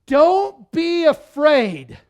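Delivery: loud, high, slow declaration; Jesus proclaiming. Retold or imitated. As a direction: draw it out as a ringing command. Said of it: "Jesus says".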